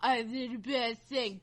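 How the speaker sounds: low, soft, whiny fake apology; mock apology. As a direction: sarcastically imitate a limp apology. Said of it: "a video that's like".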